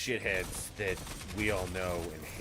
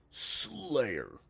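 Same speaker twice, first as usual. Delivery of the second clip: louder, drawn-out announcer call of the game mode; Halo announcer impression; 4 dB louder than usual. Streamer quotes the Halo announcer starting a game, a light imitation. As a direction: announce it like the match begins. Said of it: "he says".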